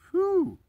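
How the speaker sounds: a high, loud whew; a high, loud whoop after the rant. Real, not acted. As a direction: blow it out high and bright like a cheer.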